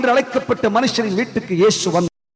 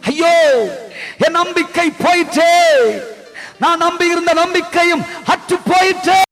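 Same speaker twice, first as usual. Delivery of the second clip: mother's lament; 8 semitones higher than usual. Acted. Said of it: "உரத்த சத்தமிட்டு".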